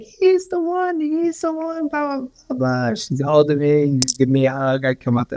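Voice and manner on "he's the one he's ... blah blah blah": high-pitched mock amazement, tapering into blahs; imitated excited wife. Imitating wife's excited repeated recognition.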